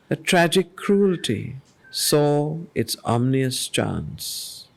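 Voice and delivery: grave, deliberate reading of epic verse; poem reading. Acted. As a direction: let each line of the poem weigh heavy. Reads the canto aloud.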